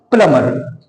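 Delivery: प्लम्बर as "plummer", falling, b dropped; silent B. Shown as a demonstration. Teacher repeating the answer for students, silent b. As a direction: leave the b out of प्लम्बर. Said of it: "बी तो silent होगा ही".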